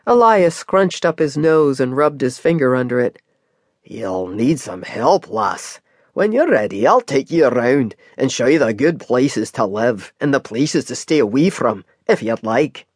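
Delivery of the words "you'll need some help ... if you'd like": Scottish cab driver. Acted. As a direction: offer help like a kindly old Scottish driver. Scottish driver voiced by reader.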